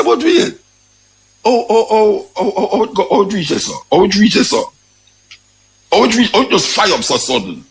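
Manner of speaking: high repeated oh's, caught off guard; mock startled cry. Retelling his own reaction, a mock startled high cry. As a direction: gasp it out like you just got startled. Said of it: "Me I say".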